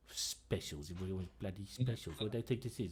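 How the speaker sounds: muttered complaint under the breath; grumbling aside. Acted. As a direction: grumble so customers can't hear. Barmaid grumbles under her breath.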